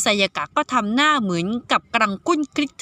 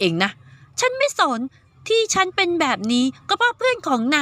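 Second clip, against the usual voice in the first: put-on bossy girl; 3 semitones higher than usual. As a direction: boss him around like a spoiled girl. Girl ordering the boy to school.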